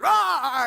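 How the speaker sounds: loud, high mock bear roar. Speaker acting the escaped bear for the audience with a mock roar. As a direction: roar like a bear bursting into the room. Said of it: "he goes".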